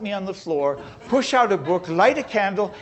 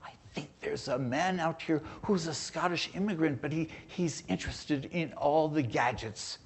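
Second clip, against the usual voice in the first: mild hushed aside, softer; quietly passing on a secret to the engineer; retold whisper; 7 dB quieter than usual. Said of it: "he whispered in his ear".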